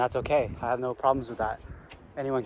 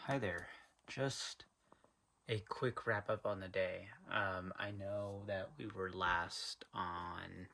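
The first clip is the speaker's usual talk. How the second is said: soft, low end-of-day voice; subdued day recap; 7 semitones lower, 16 dB quieter than usual. Real, not acted. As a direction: talk quietly and low, winding down the day. Late quiet wrap up of the day.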